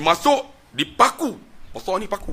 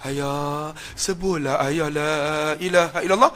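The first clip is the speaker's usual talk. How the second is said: mournful tone; slower than usual. Retold or imitated. Son whispering the creed to his father, mournful. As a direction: coax the dying man gently. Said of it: "dia buat nada sayu".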